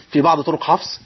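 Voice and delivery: ordinary talk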